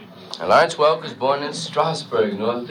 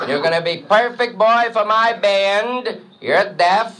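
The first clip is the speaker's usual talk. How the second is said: put-on high, accented, chirpy bandleader voice; 5 semitones higher than usual. Bandleader interviews a hip trumpet player, high and chirpy. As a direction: play the square TV bandleader hiring a jazzman. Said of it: "we hear [name] interviewing the new trumpet player".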